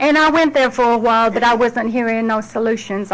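normal talking voice

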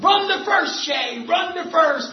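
put-on high shouted cheer urging the boy on; team yelling. The boys cheering Shay toward first base, shouting. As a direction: yell it like a whole team cheering from the bench. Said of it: "And everybody yelled".

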